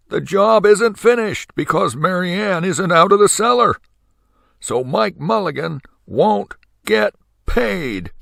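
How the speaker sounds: a petty official smirking; sly mean official. A narrator's reading. Henry B. Swap gloating over the crowd, high and mean.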